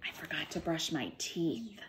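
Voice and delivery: lively storybook read-aloud with character voices; picture-book storytime voice; reading to children. Acted. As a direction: read it to a class of little ones. Teacher reads picture book aloud.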